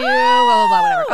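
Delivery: high, loud dismissive blah blah; mocking blah blah. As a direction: squeal it off like mock chatter. Mocking small talk.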